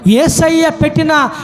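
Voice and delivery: insistent list climbing in pitch to a high peak; preaching crescendo. Real, not acted. Preaching builds to climax. (క్రమంగా స్వరం పెంచుతూ ప్రసంగం)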